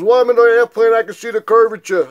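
scornful imitation of the brother bragging; mocking brother. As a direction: ham up his smug airplane claim.

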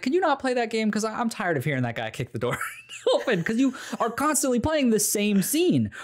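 high, laughing complaint; plead, half laughing, to stop; lightly voiced annoyed wife. His wife complaining about the game, high laughing plea. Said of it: "She's like".

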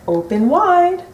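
high, bright hare voice. Acted. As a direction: coax it like a kindly doctor. Hare speaks in character.

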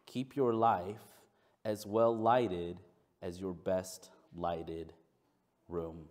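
slow, measured reading with long pauses; scripture reading. Acted. Pastor opens his sermon with a passage, slow reading. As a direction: read it out deliberately, phrase by phrase.

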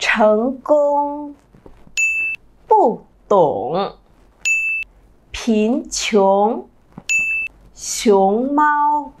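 slow, carefully paced model words with open ung; teacher drilling examples. Shown as a demonstration. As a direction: read each word deliberately. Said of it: "cùng đọc một vài ví dụ".

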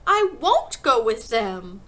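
loud, slow, emphatic protest; stretched-out loud refusal; shouted refusal. A narrator's reading. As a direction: dig in as a defiant child. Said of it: "Matilda shouted suddenly".

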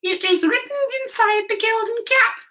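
very high, squeaky little mouse voice; squeaky mouse queen. A narrator's reading. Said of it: "in her squeaky little voice".

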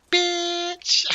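very high, loud, stretched squeal; hammed-up falsetto, drawn out; sketch punchline. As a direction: squeal it long for the laugh.

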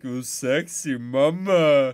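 put-on loud, slow Indian-accented come-on. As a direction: draw it out as a hammed-up Indian flirt. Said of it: "he comes back the next week".

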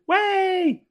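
very high delighted squeal; thrilled squeal of disbelief; excited surprise. Real, not acted. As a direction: shriek it in happy disbelief. Reacting to vaccine news.

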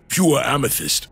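Kratos voice. Acted. Holds Kratos voice.

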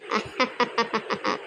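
low, soft, measured laugh; exorcist's laugh. Acted. Exorcist greeting the possessed girl, low laugh. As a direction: laugh low in separate beats.

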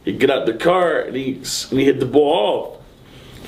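ordinary talk

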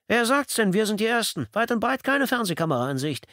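high, loud eager voice; a keen young photographer, pleased to be first; triumphant photographer, thrilled at no competition. A narrator's reading. Narrator voices Porter.

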